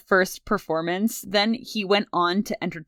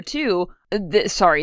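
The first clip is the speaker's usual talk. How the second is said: usual speaking voice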